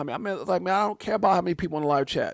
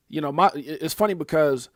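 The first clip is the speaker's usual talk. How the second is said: ordinary delivery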